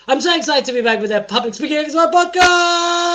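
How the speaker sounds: high, loud hype with the last word held long; over-excited announcer. Pretend. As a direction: whoop up the show, stretching "podcast". Hyped show welcome, last word held.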